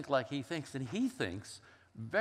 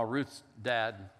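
ordinary talk

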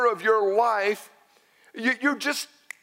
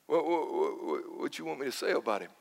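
soft, lowered, stammering helplessness; helpless muttered protest; 5 semitones lower, 9 dB quieter than usual. Acted. Preacher asked to do the funeral, helpless and quiet. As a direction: stumble quietly into the question, at a loss.

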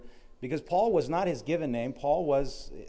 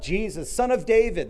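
raised, higher and louder call; yelling out; 8 semitones higher, 6 dB louder than usual. Retold or imitated. Blind beggar calls to Jesus as he passes, yelling out. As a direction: call out over a crowd. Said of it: "so he starts yelling out".

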